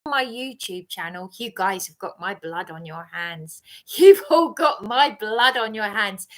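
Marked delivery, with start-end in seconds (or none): 1.4-3.6 quiet accusation. Real, not acted. Accusing viewers, first quiet. 4.0-6.3 louder repeat, jabbing at everyone; loud accusation. Real, not acted. Accusing his YouTube viewers of his ruin, louder.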